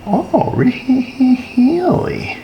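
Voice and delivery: high, slow drawn-out disbelief; surprised. Real, not acted. Surprised finding no power.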